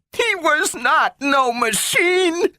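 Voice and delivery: put-on girl defending her robot friend; fierce scream. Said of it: "screamed Gloria, fiercely".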